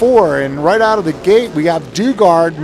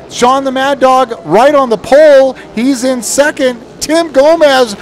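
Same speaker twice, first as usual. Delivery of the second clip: excited; 6 semitones higher, 4 dB louder than usual. Real, not acted. Live race call, rising energy.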